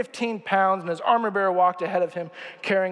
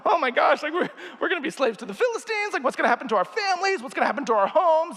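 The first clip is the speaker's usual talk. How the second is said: higher, fast, half-laughing worried rush; mock panic; 7 semitones higher than usual. Israelites imagining slavery.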